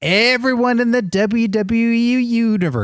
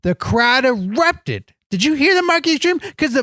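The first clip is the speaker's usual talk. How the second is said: ordinary talk, nothing marked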